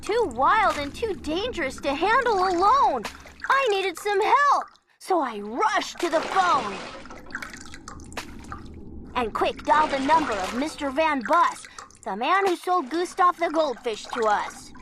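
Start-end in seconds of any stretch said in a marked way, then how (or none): none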